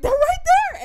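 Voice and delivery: very high, loud squeal; retold frantic shout. Fan pointing out idols to her oblivious friend, frantic shout. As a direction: shout it at a friend who won't look.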